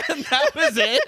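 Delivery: high, laughing through it. Real, not acted. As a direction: laugh as you say it.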